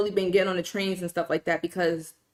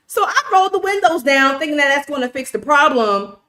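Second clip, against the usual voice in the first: loud, indignant and higher; outraged rant; 3 semitones higher, 4 dB louder than usual. Real, not acted. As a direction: rant in disbelief at the driver. Outraged rant about the driver.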